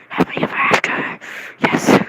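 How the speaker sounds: loud, airy squealed whisper; ecstatic fantasy. Retold or imitated. Fan picturing happily ever after, ecstatic. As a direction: hiss it out in overjoyed triumph. Fantasy peaks in fan's head.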